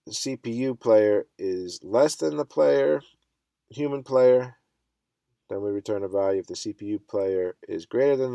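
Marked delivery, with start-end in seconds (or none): none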